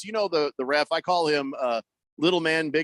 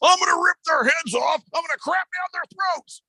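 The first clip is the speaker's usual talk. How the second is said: much higher, hammed-up shouting threats; Dan Campbell fired-up threats; 14 semitones higher than usual. A coach vows revenge at a press conference with high, hammed-up shouting. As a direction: bellow it like a coach promising carnage. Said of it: "That was Dan Campbell's press conference".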